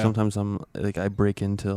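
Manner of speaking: usual speaking voice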